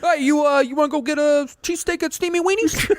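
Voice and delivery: high, hammed-up East Coast pitchman; East Coast accent. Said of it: "we're terrible at this accent".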